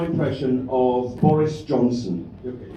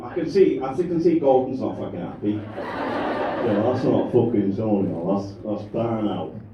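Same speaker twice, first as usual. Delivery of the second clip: ordinary delivery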